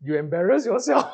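a teasing line that breaks into laughter; laughing tease. Real, not acted. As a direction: tease them through a laugh. Teacher jokes, then laughs.

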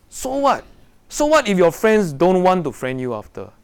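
high, loud, defiant "so what" repeated; fiery preaching. Real, not acted. Preaching with rising force.

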